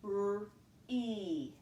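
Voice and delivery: R-E with the long e held at the end; vowel at end. Shown as a demonstration. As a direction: stretch the e at the end of R-E. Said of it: "Let's listen for where we hear that vowel".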